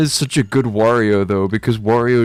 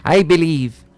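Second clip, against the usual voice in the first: orate like a cartoon plumber president; lightly voiced mock presidential speech; Luigi as president; 5 semitones higher, 3 dB louder than usual. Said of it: "What's Luigi's voice sound like?".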